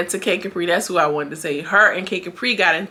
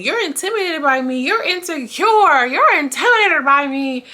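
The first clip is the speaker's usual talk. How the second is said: mocking Lollipop; 9 semitones higher, 5 dB louder than usual. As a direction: sneer it up high like the rival. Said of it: "Lollipop says the same thing".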